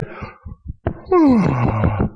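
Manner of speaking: a long, falling, tearful sigh; heavy sigh. Real, not acted. Preacher sighing with grief over the apathetic church. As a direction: let a tearful sigh fall away.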